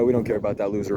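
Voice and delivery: normal talking voice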